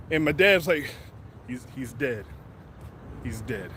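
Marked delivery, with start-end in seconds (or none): 1.5-3.7 much lower and softer, numb; a dad numbly murmuring his son is dead; stunned hush. Retold or imitated. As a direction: say it under your breath, numb. Dad's shocked whisper of despair.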